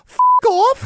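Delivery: put-on very high, loud toddler blurting a swear; little British kid. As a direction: shout it like a cheeky tiny child. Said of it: "he just goes".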